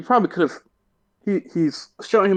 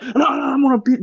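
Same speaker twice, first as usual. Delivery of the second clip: high-pitched, mock face-off threat; mock trash talk; 12 semitones higher than usual. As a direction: imitate a fighter squaring up, pitched way up. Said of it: "get in each other's face".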